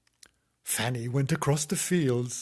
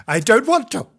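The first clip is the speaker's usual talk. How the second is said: put-on loud, high childish refusal; pitched-up, much louder child; defiant little girl; 11 semitones higher, 12 dB louder than usual. A narrator's reading. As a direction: shout it like a brat. Quotes Fanny's refusal again.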